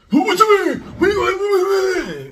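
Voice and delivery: high, yelling challenge; hammed-up high-pitched outrage; mock confrontational shout. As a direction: act out squaring up, shrieking high. Acts out an angry confrontation.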